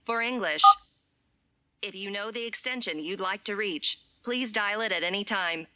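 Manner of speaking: automated recorded menu voice; robotic hotline recording, evenly paced; phone menu voice. Acted.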